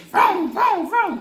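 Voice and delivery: high, loud revving car sound; engine noise. Retold or imitated. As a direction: rev it like a racing engine. Imitating the speeding car.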